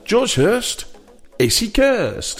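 拖长的游戏节目口号; game show host. Acted. Game title repeated.

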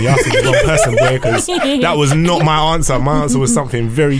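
laughing loud while talking. Real, not acted. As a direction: laugh through the words.

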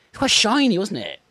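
sudden high squeal of delight; excited. Acted. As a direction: gush about the shiny skull. Excited about ram skull.

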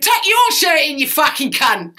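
high, loud retort with YOUR punched hard; acted outraged comeback from the stage; shouted comeback. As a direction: yell it back at the heckler, hitting YOUR. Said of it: "And he went".

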